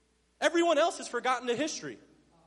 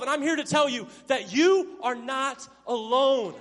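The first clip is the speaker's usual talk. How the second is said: ordinary delivery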